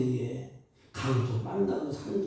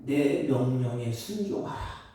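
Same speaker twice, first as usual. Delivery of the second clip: voice of the Lord; 7 semitones lower than usual. Acted. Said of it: "주님이 한 걸음 더 나아가서 이렇게 말씀하십니다".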